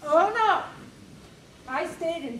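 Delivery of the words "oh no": emphatic no. Acted. Emphatic refusal to quit.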